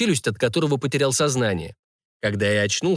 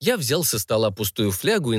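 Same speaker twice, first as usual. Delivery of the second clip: ordinary delivery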